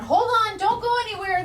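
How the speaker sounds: high, loud panicky squeal; excited squeal. Real, not acted. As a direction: squeal it in a frantic hurry. Panicked search for gush pad.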